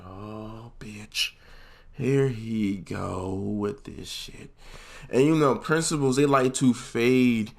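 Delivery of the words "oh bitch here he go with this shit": slow, hushed aside of dismay; resigned dread. Retold or imitated. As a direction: draw it out under your breath, sinking. Sinking feeling as principal tells mom.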